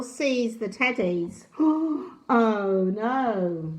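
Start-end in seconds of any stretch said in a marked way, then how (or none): 1.6-2.2 sharp dramatic gasp; mock-alarmed gasp; storyteller gasp. A narrator's reading. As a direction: gasp for the listeners. Narrator reacts to wandering child.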